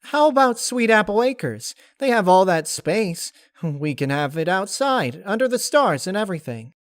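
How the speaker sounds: high, loud and bubbly; excited suggestion. Acted. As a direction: pitch the idea with glee.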